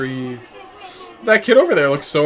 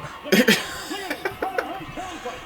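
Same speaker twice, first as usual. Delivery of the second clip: high-pitched burst of laughter; 6 dB quieter than usual. Real, not acted. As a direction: laugh at the joke. Laughing at own joke.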